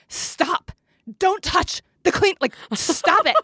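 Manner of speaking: sharp, high scolding snap; snapping at child. Retold or imitated. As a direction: bark at the kid to stop. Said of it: "And I bit his head off".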